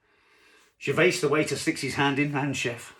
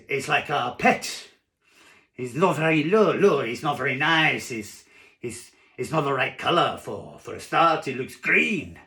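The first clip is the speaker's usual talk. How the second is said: louder, higher French waiter, protesting; badly faked French accent; 4 semitones higher, 7 dB louder than usual. Waiter talks diner out of squid, French accent. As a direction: plead in a hammed-up French accent. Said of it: "I can't do a French accent".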